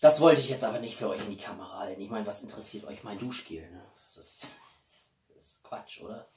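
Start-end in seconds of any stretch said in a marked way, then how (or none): 2.0-6.3 whispered, much softer; a soft whispered aside to viewers. Real, not acted. Aside about shower gel. (geflüstertes Beiseite)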